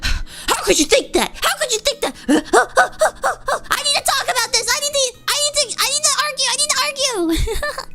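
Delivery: high, eager and worked up; excited. Retold or imitated. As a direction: play it worked up and eager to argue. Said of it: "I get excited".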